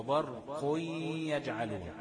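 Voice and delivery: برق يجعلون drawn out slowly, the nun merged into يجعلون; slow correct recitation. Shown as a demonstration. Tajweed teacher modelling the correct idgham: slow recitation. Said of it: "هقلب النون الساكنة".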